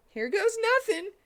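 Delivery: put-on very high boyish yell; little boy yell. A narrator's reading. Tails bracing for the wish, very high and boyish.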